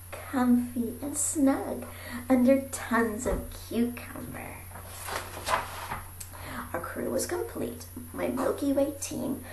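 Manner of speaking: plain reading voice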